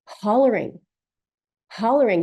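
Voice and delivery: loud, falling holler; a loud, raw holler. Real, not acted. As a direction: let it out as a real holler. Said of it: "I'm, like".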